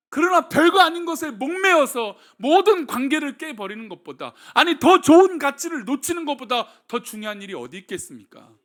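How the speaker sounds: loud, high, urgent sermon delivery; full-force preaching. Acted. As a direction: preach it hard to the congregation. (힘차게 외치는 설교)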